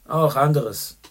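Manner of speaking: short, clipped-off answer; curt. A narrator's reading. Chandler curtly hints at O'Hara's vices. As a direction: cut the topic short. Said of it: "sagte Little Chandler knapp".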